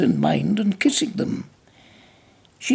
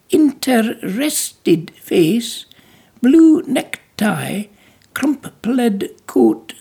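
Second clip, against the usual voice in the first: high, slow, half-whispered listing of his clothes; a childlike woman slowly sizing up a stranger; childlike wonder; 4 semitones higher, 5 dB louder, much slower than usual. Acted. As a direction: take in every detail like a fascinated child. Belle lists his clothes.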